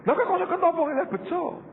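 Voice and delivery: lightly voiced high surprised question; mock-surprised high question; mock wonder. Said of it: "saya berkata".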